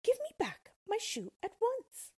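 high, loud, cross demand; cross girl. Acted. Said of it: "said Dorothy crossly".

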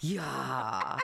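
"Yeah" low, drawn out, with a laugh. Shown as a demonstration. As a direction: drop "Yeah" deep and long. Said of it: "We say it lower".

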